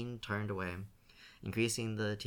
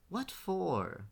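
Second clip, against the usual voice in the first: suspicious; 9 semitones higher than usual. A narrator's reading. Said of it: "Gerda asked suspiciously".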